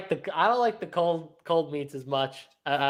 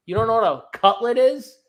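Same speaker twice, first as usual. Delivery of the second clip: incredulous; 6 semitones higher, 5 dB louder, faster than usual. Retold or imitated. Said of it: "I'm like".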